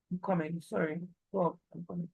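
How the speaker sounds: quiet interruption, not for the class; hushed aside. Real, not acted. Quick aside to someone off the lesson.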